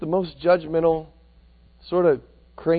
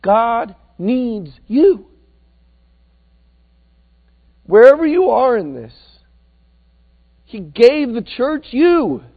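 rising preaching; 6 semitones higher, 6 dB louder, slower than usual. Acted. Preaching at full force.

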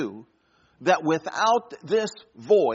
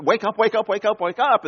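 urgent repeated calls, a bit louder and higher; frantic shouting; 6 semitones higher, 4 dB louder than usual. Retold or imitated. Passengers yelling at the fainted pilot, frantic. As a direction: shake him awake with your voice.

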